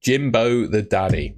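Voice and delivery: normal talking voice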